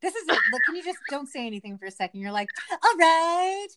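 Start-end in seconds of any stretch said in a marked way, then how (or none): 2.7-3.7 high, mocking host sign-off; weird sign-off voice. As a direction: imitate a co-host's odd closing voice, pitched up. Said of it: "weird voice at the end".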